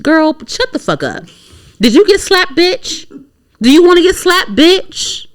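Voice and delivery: high-pitched incredulous clapback; mock confrontation. Snapping back at a comedian's post, high mock confrontation. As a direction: go high and drag out the taunting questions.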